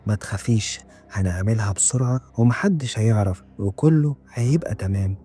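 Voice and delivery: ordinary delivery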